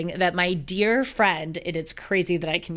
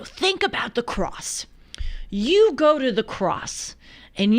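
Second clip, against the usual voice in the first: higher, slow, emphatic mock Bronx accent; thick Bronx accent, imitated; 5 semitones higher, 3 dB louder than usual. Host quoting friend on carrying troubles to the cross, imitated Bronx accent. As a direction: punch it out like a Bronx wise guy preaching. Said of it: "he has this thick Bronx accent".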